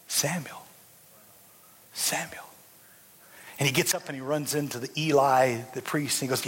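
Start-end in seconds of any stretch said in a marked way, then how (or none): 0.1-2.4 whispered call. Acted. God calling boy at night.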